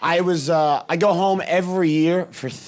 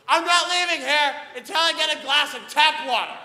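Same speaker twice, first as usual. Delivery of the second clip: screaming; 10 semitones higher than usual. Retold or imitated. Comedian screaming at cupcake shop staff, furious. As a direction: scream it at the cashier like a lunatic. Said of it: "I'm screaming at someone".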